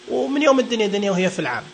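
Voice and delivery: usual speaking voice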